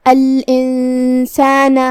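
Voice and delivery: الانسان with the ن hidden into a nasal hum before س, slow and chanted; nasal ikhfa. Shown as a demonstration. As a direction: recite الانسان slowly, hum the noon through the nose. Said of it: "بغیر ظاہر کیے ہوئے لیکن غنہ کے ساتھ". (الانسان میں نون کو غنہ کے ساتھ چھپا کر)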